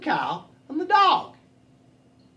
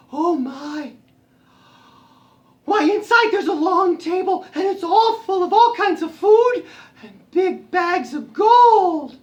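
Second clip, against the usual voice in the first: high cat voice, growing louder; amazed cat; 10 semitones higher, 6 dB louder, slower than usual. Acted. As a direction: build in wonder at the find. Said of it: "and she said".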